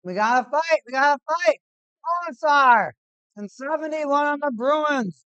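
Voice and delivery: loud, high hockey-fight yelling; excited shouting. Real, not acted. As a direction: yell it as the gloves drop. Fight breaks out on ice.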